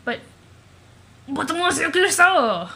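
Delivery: high, loud, drawn-out cry; hammed-up loud exclamation; exclaimed protest. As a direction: cry out the name dramatically. Acted surprised cry at disguise.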